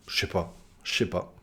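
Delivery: low, soft "chais pas". Shown as a demonstration. Answering a question about arrival time, "je sais pas" as quiet "chais pas". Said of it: "en le disant très vite".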